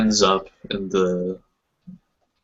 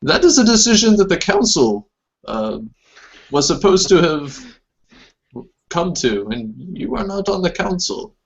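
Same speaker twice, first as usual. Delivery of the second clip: higher, stiff reproving council elder; disapproving elder; 9 semitones higher than usual. Acted. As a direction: scold her for overstepping.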